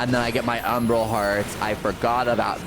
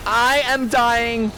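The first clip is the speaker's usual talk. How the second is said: panicked high cry. Real, not acted. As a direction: cry out as you lose. Losing the fight.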